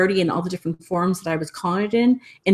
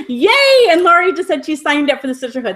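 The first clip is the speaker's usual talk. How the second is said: excited cheer; 9 semitones higher, 6 dB louder than usual. Real, not acted. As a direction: cheer the news out loud. Cheering a new sign-up.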